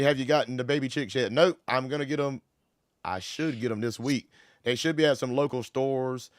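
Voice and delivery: ordinary talk, nothing marked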